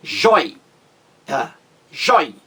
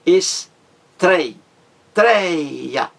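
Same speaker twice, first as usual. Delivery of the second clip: "estrei, treia" with TREI loud and held; stress on TREI; 3 semitones higher, 5 dB louder, much slower than usual. Shown as a demonstration. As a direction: pause after es, then hit TREI hard. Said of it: "A mais forte é a penúltima".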